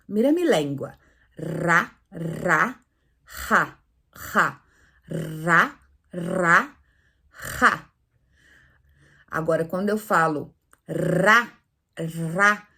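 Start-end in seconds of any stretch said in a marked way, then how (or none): 1.4-7.9 "Ra" tapped, "ja" rasped in the throat; ra versus ja drill. Shown as a demonstration. Teacher drilling mouth versus throat: tapped "ra", throaty "ja". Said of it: "Mira la diferencia de mi boca para mi garganta".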